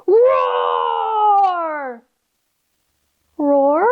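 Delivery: big shouted roar; lion roar. Acted. Lion frightening the gorillas, big roar.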